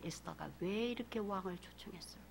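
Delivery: barely audible musing whisper; soft low whisper. Real, not acted. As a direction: let the question hang in a whisper. Question trails to whisper.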